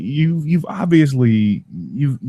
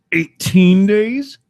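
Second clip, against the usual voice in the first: slow, higher and louder disbelieving question; incredulous; 9 semitones higher, 5 dB louder, much slower than usual. Real, not acted. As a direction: stretch it out in disbelief. Counting days past warranty.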